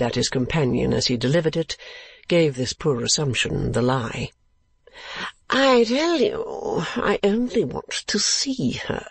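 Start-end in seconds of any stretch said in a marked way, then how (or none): 5.5-9.1 put-on high, slow drawling young lord; lordly client crying out. Client protesting to Ralph about the niece, high drawling cry. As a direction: insist in a high foppish drawl. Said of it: "cried his client".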